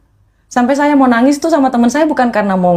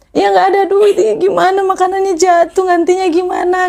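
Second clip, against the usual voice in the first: retold tearful panic; 12 semitones higher than usual. No money to replace the dropped food, imitated tearful pleading. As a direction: plead helplessly, as if crying. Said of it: "Saya langsung nangis".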